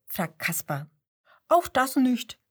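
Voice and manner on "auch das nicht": jammernd. A narrator's reading. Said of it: "jammert der Seppel".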